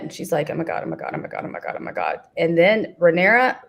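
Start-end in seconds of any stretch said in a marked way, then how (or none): 0.5-2.2 hushed, rapid-fire mock panic; imitated anxious muttering; nervous fretting. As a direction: mutter it over and over, very soft. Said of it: "it's a nervous habit".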